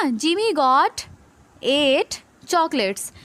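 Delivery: very slow, word by word; slow read-aloud. Shown as a demonstration. Teacher reading the example sentence, very slowly. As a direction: drag out each word for the class. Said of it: "क्या लिखा है ये".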